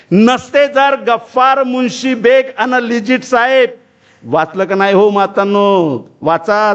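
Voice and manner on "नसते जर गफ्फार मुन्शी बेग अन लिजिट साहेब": high, slow declamation; poem recitation. Acted. Orator reciting Savitribai's poem to the mothers, slow declamation. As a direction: declaim the verse slowly on a raised pitch. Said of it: "काय आहे ती कविता?".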